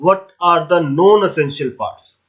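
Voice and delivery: slow, spaced dictation of an exam question; dictating a question. Shown as a demonstration. Teacher dictating the exam question slowly to students. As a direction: read each word out slowly for notes.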